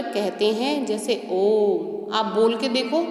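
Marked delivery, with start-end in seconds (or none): 1.3-1.9 ओम stretched long and slow, held like a chant; drawn-out om. Shown as a demonstration. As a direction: draw out ओम as a prolonged vowel. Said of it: "हम इसको खींच रहे हैं".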